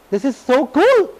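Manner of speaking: high, drawn-out mock delight; thrilled discovery. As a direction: imitate a friend gushing over a find. Quotes Jacqueline thrilled by lipstick discovery.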